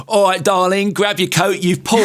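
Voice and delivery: put-on Essex accent. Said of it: "with an Essex accent".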